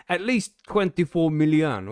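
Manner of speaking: slow, high, loud mock-Italian accent; attempted Italian accent. Host trying a European accent for his co-host, mock Italian. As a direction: have a go at a rough Italian accent. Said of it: "was that Italian".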